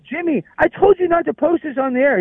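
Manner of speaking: very high, indignant retold complaint; exasperated reproach. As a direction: reproach him for disobeying, pitch way up. Retold scolding over posted video.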